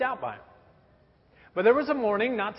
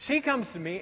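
ordinary talk, nothing marked

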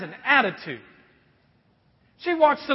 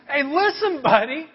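higher, louder mock attitude; woman with attitude; 4 semitones higher, 5 dB louder than usual. Woman at the well to Jesus, mock sassy attitude. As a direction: put him in his place, playfully. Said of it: "she kinda has this attitude".

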